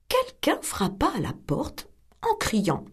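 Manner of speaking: usual speaking voice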